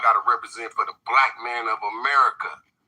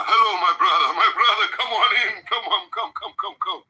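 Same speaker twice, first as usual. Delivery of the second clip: mock welcoming host; 6 semitones higher than usual. Imitating sly African host welcoming.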